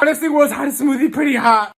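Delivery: strained from spice. Real, not acted. Still burning, speaking high.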